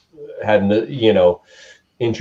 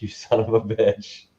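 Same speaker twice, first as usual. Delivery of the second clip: slow, softened mock curse; mock cursing; 5 dB quieter, slower than usual. Friend to the buddy who bought his guitar, mock cursing. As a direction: draw out a half-joking insult, slightly hushed.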